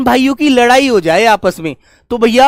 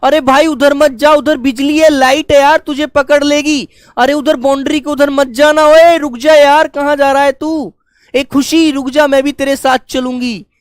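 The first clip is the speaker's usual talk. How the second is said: louder, higher urgent warning call; 4 semitones higher, 4 dB louder than usual. Retold or imitated. Teacher acting a warning call about electric wires. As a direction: shout him back from the live wires. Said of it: "आप उसको आवाज लगाएंगे".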